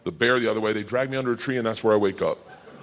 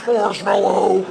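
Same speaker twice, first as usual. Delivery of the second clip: high, drawn-out mock cry for help; strained cry; 7 semitones higher, 4 dB louder, much slower than usual. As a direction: squeeze out a desperate plea. Muffled desperate cry while pinned.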